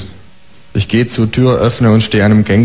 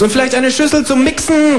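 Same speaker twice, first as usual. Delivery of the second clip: high, called-out kangaroo line; kangaroo calling after. Acted. Kangaroo calling after the narrator down the hall, high. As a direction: shout it after him, squeaky. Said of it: "ruft mir das Känguru hinterher".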